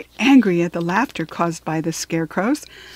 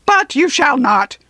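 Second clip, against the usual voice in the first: higher, loud, forceful refusal; rough guard voice; 5 semitones higher, 5 dB louder than usual. A narrator's reading. Hammerhead refusing the Scarecrow passage, rough and forceful. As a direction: forbid it in the raised rough voice. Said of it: "replied the voice".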